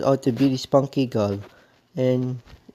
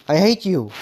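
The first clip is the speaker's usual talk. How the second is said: higher and louder, furious; real angry yell; 5 semitones higher, 4 dB louder than usual. Real, not acted. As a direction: shout it at him in real anger. Furious rant at the YouTuber.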